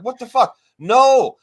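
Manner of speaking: imitating a friend's shocked reaction; shocked outburst. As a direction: blurt it in disbelief. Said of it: "You're like".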